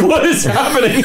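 high, laughing bewildered question; laughing disbelief. Retold or imitated. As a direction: ask it through a laugh. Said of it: "I'm like".

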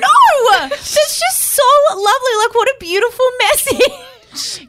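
overwhelmed by a lovely voicemail; laughing through tears. Real, not acted. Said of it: "Kelly's crying, guys".

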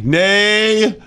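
mock preacher. Added mock preacher word.

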